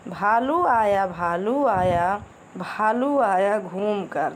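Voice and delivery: slow sing-song nursery rhyme chant; rhythmic poem recitation. Acted. Teacher reciting a rhyme to children, slow and sing-song. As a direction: lilt each line slowly for little kids.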